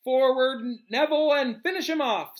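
high, loud teacher's order; commanding call. A narrator's reading. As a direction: call it out to spur the pupil on. Lupin orders pupil forward.

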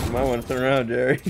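loud mocking warning; shouting a teasing warning at Jerry; loud teasing call. Real, not acted. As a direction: call it out loud to tease a friend. Taunting teammate being shot.

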